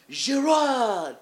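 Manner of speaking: high, loud, repeated call; mock ref bellowing "Gerard"; calling out a name. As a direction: shout the name across the hall twice.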